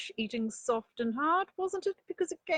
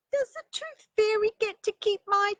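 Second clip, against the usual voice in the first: high, loud little bunny voice; a small child bursting to show off her tooth; excited little rabbit; 11 semitones higher, 7 dB louder than usual. Acted. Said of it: "said Mother Rabbit".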